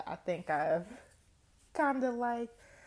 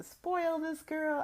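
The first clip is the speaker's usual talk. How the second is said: high gushing delight; gushing aunt; 10 semitones higher, slower than usual. Real, not acted. Gushing over niece.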